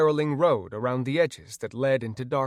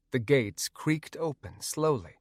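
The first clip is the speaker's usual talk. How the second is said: ordinary delivery, nothing marked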